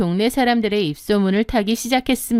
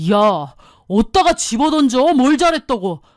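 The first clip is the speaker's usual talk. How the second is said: a hint of an indignant husband; lightly voiced angry husband; 3 semitones higher, 4 dB louder than usual. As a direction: scold her for throwing the keys. Husband scolding wife. (살짝 흉내 낸 화난 남편)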